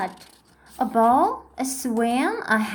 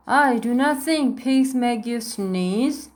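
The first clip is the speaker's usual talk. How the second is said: usual speaking voice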